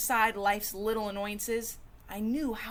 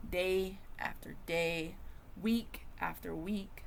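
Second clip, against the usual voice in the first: weary slow; 3 semitones lower, 6 dB quieter, slower than usual. Acted. Slow weary recital of grudges.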